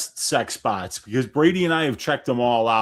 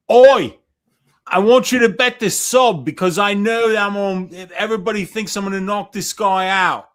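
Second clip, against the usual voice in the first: high, loud Aussie holler; Quillan Salkild, Aussie accent; 7 semitones higher, 8 dB louder than usual. Acted. Podcaster mimics Aussie fighter telling buddies to bet, hammed-up Aussie accent. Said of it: "can you do an accent of, uh, Quillan Salkild".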